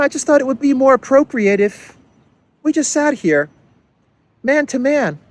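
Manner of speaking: a hammed-up gentle Trudeau; sweet mock Trudeau. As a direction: coo an apology to soften the blow. Trudeau apologizes sweetly in skit.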